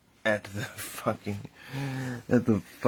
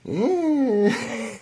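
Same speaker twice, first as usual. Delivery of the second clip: high shrug sound; 13 semitones higher than usual. Retold or imitated. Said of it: "And they're like".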